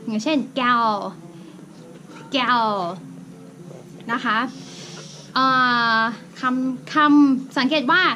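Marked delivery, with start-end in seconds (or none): 0.6-2.6 "gal" drawn out into its final l; final L demo. Shown as a demonstration. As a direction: show the ending l in "gal". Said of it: "ถ้าสมมติว่าตัว l ลงท้าย".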